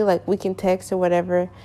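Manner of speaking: usual speaking voice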